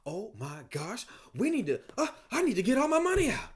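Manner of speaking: high, loud mock panic, rising fast; panicked depositor. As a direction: play a frightened customer rushing to pull their money. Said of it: "get freaked out".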